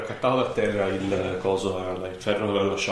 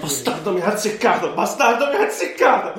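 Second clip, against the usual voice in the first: rising furious cry, louder and higher; angry pained curse; 10 semitones higher, 5 dB louder than usual. Acted. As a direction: yell it as the wound lands. Character hit, cursing in pain.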